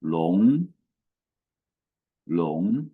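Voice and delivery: Long sliding upward; rising tone demo. Shown as a demonstration. Teacher modelling dragon in Chinese, rising tone. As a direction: lift Long up at the end. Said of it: "How do you say dragon in Chinese?".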